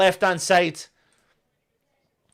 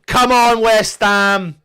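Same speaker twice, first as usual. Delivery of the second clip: cheering; 3 semitones higher, 7 dB louder, slower than usual. Real, not acted. Urging the team.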